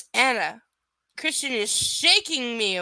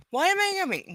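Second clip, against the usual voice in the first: "Why am I hiccuping?" pitched high, louder, fed up; exasperated high cry; 11 semitones higher, 5 dB louder, much faster than usual. Real, not acted. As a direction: yelp "Why am I hiccuping?" in frustration.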